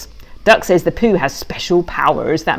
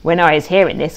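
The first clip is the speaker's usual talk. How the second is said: plain reading voice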